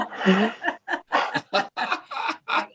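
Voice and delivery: high breathless laugh; real laugh of disbelief; laughing in shock at the number; burst of laughter. Real, not acted. Stunned by the lift number.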